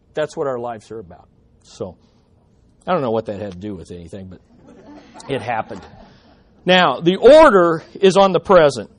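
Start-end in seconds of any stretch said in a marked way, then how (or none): 0.1-5.8 trailing off aside. Real, not acted.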